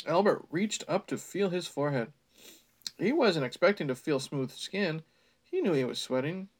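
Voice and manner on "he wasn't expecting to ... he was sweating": ordinary delivery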